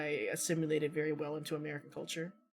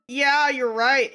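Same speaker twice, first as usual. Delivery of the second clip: loud, high, drawn-out reenacted astonishment; amazed agreement; 9 semitones higher, 13 dB louder, much slower than usual. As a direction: agree as if stunned at being found out. Acting amazed reply to stranger.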